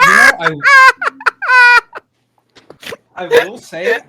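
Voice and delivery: words shouted through loud, high laughter; laughing. Real, not acted. Friend talks through a fit of loud laughter. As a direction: tries to talk but can't stop laughing.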